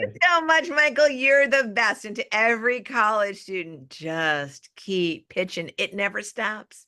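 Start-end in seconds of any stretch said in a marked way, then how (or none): 0.0-2.0 excited thanks. Real, not acted. Excited goodbye. 3.9-5.7 slow motto. Acted.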